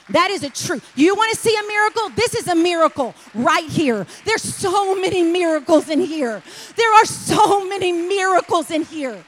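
loud, high preacher's shout; preaching at full force. Acted. Preacher urging the congregation to surrender, shouting.

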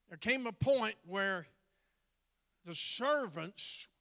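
loud, slow, drawn-out preaching; preaching at full force. Acted. Preacher to congregation, slow booming sermon delivery. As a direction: build it up from the pulpit, slow and loud. Preacher building the wine story.

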